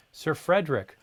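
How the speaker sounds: ordinary delivery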